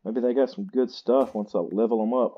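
usual speaking voice

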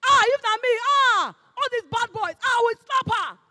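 mock crowd shouting at the roadside; bystanders shouting. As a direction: shout it like an angry onlooker. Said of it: "People were shouting".

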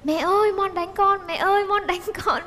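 high, whiny fake complaint to mom; wife faking hurt, calling mother-in-law while hitting husband; tattling to mom. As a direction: pretend to be the victim, wailing for mom. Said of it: "em vừa kêu".

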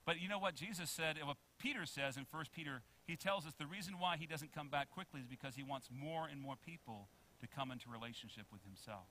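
usual speaking voice

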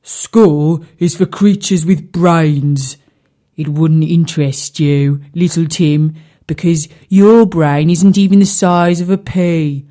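grumpy carp. A narrator's reading. Colin the carp scolding little Tim, grumpy and loud. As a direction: sneer it down at the tadpole, low and heavy. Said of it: "a bit of a grumpy fish".

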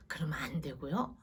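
normal talking voice

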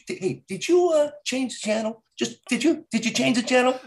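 mock mafia guy confronting someone; mobster impression. Acting out Italian mobsters in a bit.

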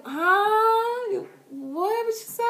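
high, drawn-up "huh?" of disbelief; mock stunned, high-pitched questioning; acted shock. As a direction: squeal it as if you misheard. Acts stunned reply to proposal.

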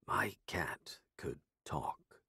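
hushed secret whisper. A narrator's reading. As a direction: breathe it out, barely voiced. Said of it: "I said it quietly".

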